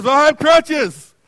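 loud, high, slow and indignant; line cutter snapping back at the accuser, loud and defensive; defensive retort. Retold or imitated. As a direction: snap back that you have crutches.

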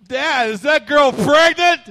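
put-on high, slow child's voice, loud and innocent; curious kid's loud question; blurting child. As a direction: pipe it up like a little kid asking out loud.

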